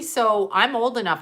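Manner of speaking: ordinary talk, nothing marked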